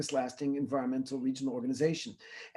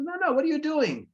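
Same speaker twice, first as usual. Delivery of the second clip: put-on high, insistent child's voice, much higher and louder; a little girl pressing her father for the real answer; imitating his young daughter; 12 semitones higher, 5 dB louder than usual. Daughter insisting, retold story.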